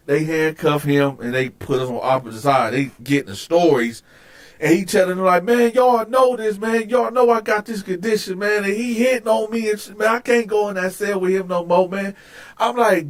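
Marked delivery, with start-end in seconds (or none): 5.4-12.1 high-pitched pleading imitation; mock the cellmate's protesting to guards; aggrieved complaint.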